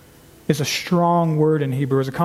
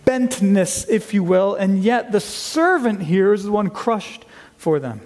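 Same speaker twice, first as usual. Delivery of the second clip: usual speaking voice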